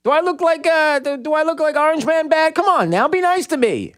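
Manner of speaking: high, whiny mock-offended old man; hammed-up aggrieved Biden whine; Joe Biden impression. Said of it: "Joe Biden doesn't like that".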